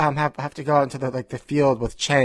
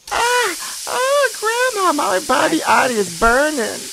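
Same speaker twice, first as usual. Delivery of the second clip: pained burning cries; 14 semitones higher, 4 dB louder than usual. Acted. Greens poured on him in skit.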